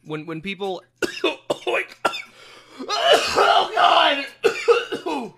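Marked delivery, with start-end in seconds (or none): 1.0-2.1 coughing mid-sentence; choking on a bite of candy. Real, not acted. Choking on almond while eating. 2.8-5.4 strained loud cry while choking. Real, not acted. Choking on almond flake.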